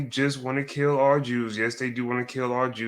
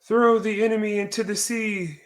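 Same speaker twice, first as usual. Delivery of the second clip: high-pitched mock British accent; 10 semitones higher than usual. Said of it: "in my British voice".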